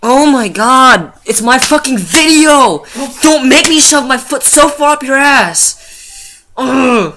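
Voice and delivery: high, loud, slow mock fury; hammed-up threat at a friend; mock angry rant.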